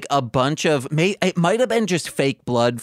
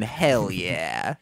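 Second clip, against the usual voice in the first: low, soft, savoured mock approval; imitated smug approval; 7 semitones lower, 5 dB quieter than usual. Boss sipping, looking out, low savouring approval. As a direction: draw it out low and quiet, relishing it. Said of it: "looking out saying".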